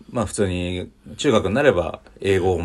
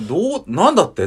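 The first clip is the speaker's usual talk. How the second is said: higher, louder mock disbelief; astonished; 10 semitones higher, 6 dB louder than usual. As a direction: act out students stunned by the news. Students hear news of teacher's wedding.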